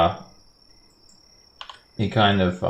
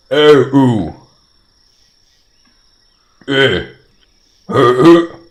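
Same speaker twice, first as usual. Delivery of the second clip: high, loud giant grunts; grunting giant; 6 semitones higher, 9 dB louder than usual. Acted. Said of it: "he says. He's just kinda grunting".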